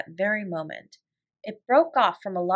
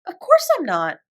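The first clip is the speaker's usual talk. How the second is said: emphatic reassurance; 9 semitones higher, 7 dB louder than usual. A narrator's reading. Sarah reassures loudly.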